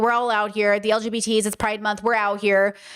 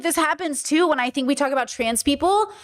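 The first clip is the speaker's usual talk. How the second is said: ordinary talk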